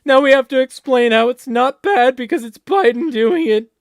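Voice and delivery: high, loud mock whiny voice; sneering impression of a Democrat spokesman. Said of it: "it's like".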